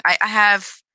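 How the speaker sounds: stumbling, repeating words; stuttering. Real, not acted. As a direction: trip over the words. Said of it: "I'm stuttering".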